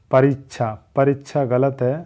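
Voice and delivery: ordinary talk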